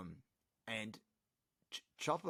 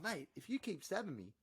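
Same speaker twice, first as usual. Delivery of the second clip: unbothered Chopper; 8 semitones higher than usual. Retold or imitated. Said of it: "he's going".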